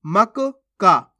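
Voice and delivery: मक्का very slowly in pieces, mak, kaa; broken-up pronunciation. Shown as a demonstration. Teacher takes it apart, मक्का slowly split. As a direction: separate the two क sounds in मक्का. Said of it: "अब यहाँ पे देखो".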